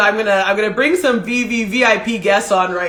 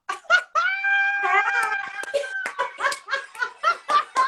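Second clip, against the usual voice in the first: high squealing laugh. Real, not acted. Host laughs as parents join.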